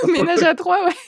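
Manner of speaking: laughing echo. Real, not acted. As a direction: repeat it through laughter.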